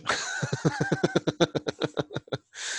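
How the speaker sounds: shrieking giggle, very high; high squealing laugh. Real, not acted. Joking about VR sickness.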